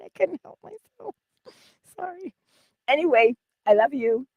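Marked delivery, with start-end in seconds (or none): none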